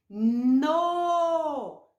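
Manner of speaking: No called out loud and long; syllable demo. Shown as a demonstration. Teacher shows children the syllable No. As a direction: sound out No loudly, drawn out. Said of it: "Vamos ver o som?".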